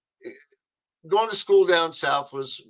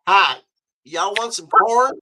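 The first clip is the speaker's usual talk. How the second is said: imitating a Southern cafeteria lady's drawl, higher and slow; Southern serving lady; 5 semitones higher, 6 dB louder than usual. Southern cafeteria lady offering corn down the line, slow Southern drawl. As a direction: stretch the Southern accent out slow and high. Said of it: "you imitate 'em, a Southern person".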